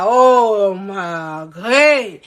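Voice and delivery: low, very drawn-out groan of dread; retold dismay. Reacting to being taken to urgent care, groaned dismay. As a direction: stretch it out in a low, sinking voice.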